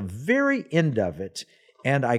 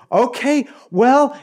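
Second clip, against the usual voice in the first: high, loud, startled acknowledgement; retold excited reply; 11 semitones higher, 7 dB louder, slower than usual. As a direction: act out being thrown, pitch jumping up. Retold flustered reply to caller.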